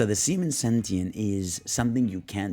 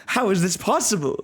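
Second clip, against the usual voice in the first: shocked disbelief; 9 semitones higher, 3 dB louder than usual. Acted. Villain reaction in acted lore.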